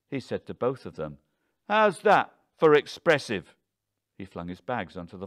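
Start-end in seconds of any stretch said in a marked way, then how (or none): 1.7-3.5 loud, high, sarcastic outburst; furious, defiant taunt; frenzied anger. A narrator's reading. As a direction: throw their word back at them loudly. Husband in frenzy flinging bags.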